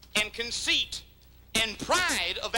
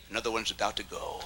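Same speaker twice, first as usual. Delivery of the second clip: soft, fast sly aside; gloating; 12 semitones lower, 6 dB quieter, faster than usual. Acted. Rival preacher gloating quietly over a fall. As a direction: murmur it like a vulture. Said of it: "You circle around and say".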